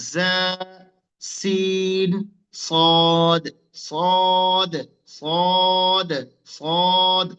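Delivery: জা, সিন, সদ each held long on a level note; letter pronunciation demo. Shown as a demonstration. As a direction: drill জা, সিন, সদ slowly, one drawn-out letter at a time. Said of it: "উচ্চারণ হবে".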